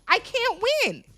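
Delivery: high exasperated whine; whiny complaint. Retold or imitated. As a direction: whine it like a defeated guy. Acted whine of a cast member.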